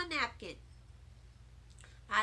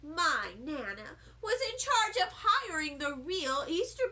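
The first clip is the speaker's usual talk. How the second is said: snooty tattling; 6 semitones higher, 4 dB louder than usual. A narrator's reading. Lucille tattling on Nana.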